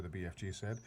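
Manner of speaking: ordinary delivery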